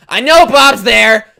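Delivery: loud, drawn-out mock demand; slow, loud insistence, hammed up; insistent demand. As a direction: imitate a stubborn caller shouting at the door. Acts Jim refusing to give up.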